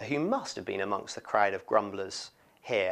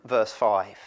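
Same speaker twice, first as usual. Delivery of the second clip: ordinary delivery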